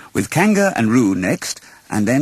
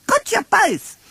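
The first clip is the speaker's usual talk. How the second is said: very high, triumphant little joey; Roo shouting; slower than usual. Acted. Roo catches Pooh and Piglet, very high and gleeful. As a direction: crow it even higher in triumph. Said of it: "shouted Roo".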